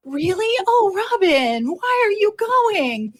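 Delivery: high, drawn-out mock dismay; mock surprised coworkers. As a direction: whine it out as astonished coworkers. Mimics surprised coworkers reacting.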